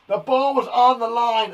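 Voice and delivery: loud, high-pitched outburst of protest; indignant sports player's outcry; McEnroe. Acted. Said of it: "I'm not gonna do that accent, or impression".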